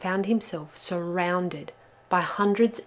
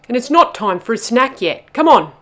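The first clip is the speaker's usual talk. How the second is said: loud, higher, bossy hurry-up; 6 semitones higher, 16 dB louder than usual. A narrator's reading. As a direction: chivvy them along loudly. Bubba hurries the others along.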